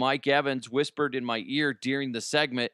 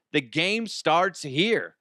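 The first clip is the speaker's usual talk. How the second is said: slogan delivery; 4 semitones higher, slower than usual. Acted. Slogan read.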